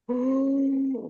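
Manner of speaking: imitating a breathless, strangled wail; hammed-up gasping cry; losing breath. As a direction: act out hollering with no air left. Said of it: "I wasn't getting enough oxygen back in my lungs".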